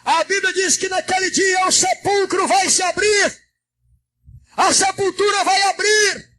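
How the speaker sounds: high, sustained shouted oratory; full-force preaching. Real, not acted. Pastor preaching the last trumpet to the church, high fervent shout. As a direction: proclaim it urgently, phrase upon phrase.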